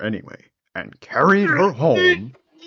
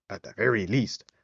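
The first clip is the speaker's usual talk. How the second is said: usual speaking voice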